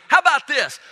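high, loud, showy dare; loud challenge. Acted. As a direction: slam it down like proof. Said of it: "he goes".

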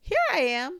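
high, loud squeaky little voice; a tiny joey showing off; little Roo squeaking with pride; squeaked. A narrator's reading. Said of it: "squeaked Roo".

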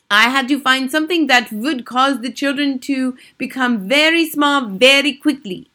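put-on high, loud accented witch lecture; imperious Grand High Witch. A narrator's reading. Grand High Witch explaining her invention, imperious.

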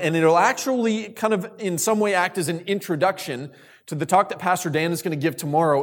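ordinary delivery

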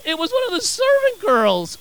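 high-pitched, scandalised disbelief; incredulous. Real, not acted. Preacher exclaiming over Peter's small accuser, incredulous. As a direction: send it way up in disbelief. Contrast with the verse just read.

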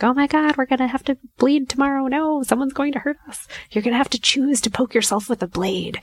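high-pitched panicked inner voice; freaking out. Acted. Anxious brain warning her about tomorrow's blood draw, mock freak-out. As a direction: wail it in mock panic, well above normal. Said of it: "is freaking the fuck out and is like".

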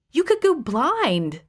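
slow, high, overdone warning; milk the danger, draw it out slowly; melodramatic. Acted. Elaine warning her husband, slow and melodramatic. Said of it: "I said melodramatically".

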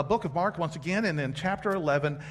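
normal talking voice

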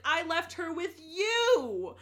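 high, loud, slow wail with a near-cry; panicked disbelief. Retold or imitated. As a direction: wail it like it's their fault. Alucard panics about Lila.

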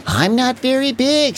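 high, kind little engine voice; little blue engine kindly asking the toys. A narrator's reading. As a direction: ask gently, like a caring friend. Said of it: "said the little blue engine".

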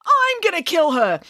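high, loud enraged yell; wild, high-pitched fury; furious threat. A narrator's reading. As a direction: shout it while charging at someone. Daisy lunges for April.

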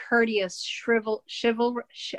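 tripping on "shrivel-, chival-, sh-", breaking off each attempt; false starts "shrivel-, chival-, sh-", each cut short; stumbling over pronunciation. Real, not acted. Wife attempts a word she never can say, stumbling "shrivel-, chival-, sh-". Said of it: "I can never say the word".

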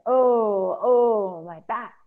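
loud, high long "oh"s, then softening; echoed cry of pain. Acted. Two little beings echo Ereshkigal, crying out in pain. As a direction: mirror the queen's moan of pain. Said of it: "these two little beings said".

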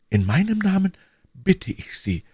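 high, pleading woman's voice; deeply moved. A narrator's reading. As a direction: beg him, pitch lifted with emotion. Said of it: "mit lebhafter Rührung". (hoch, flehend, mit lebhafter Rührung)

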